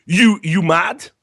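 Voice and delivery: slow, high, drawn-out taunt; mocking taunt. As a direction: stretch it out to needle him. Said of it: "then it types".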